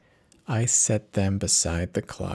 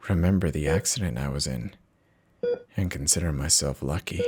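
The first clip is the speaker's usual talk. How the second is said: ordinary delivery, nothing marked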